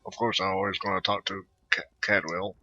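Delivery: ordinary delivery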